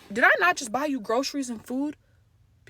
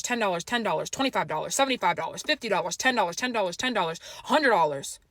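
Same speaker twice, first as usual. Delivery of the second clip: ordinary talk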